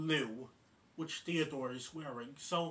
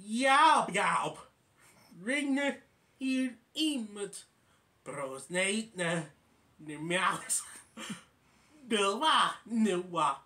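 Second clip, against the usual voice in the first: garbled gibberish; 4 semitones higher, 5 dB louder, slower than usual. Acted. Garbled phone message gibberish.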